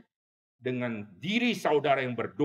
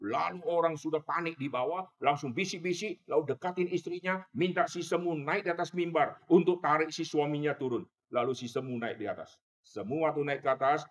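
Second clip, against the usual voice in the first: usual speaking voice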